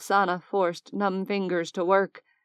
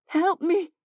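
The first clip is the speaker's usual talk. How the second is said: high, desperate plea for help; frantic begging; 10 semitones higher than usual. Acted. Oksana begs Xander to save dying Mason, frantic. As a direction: beg frantically, voice pitched up high. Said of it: "she begged, frantic".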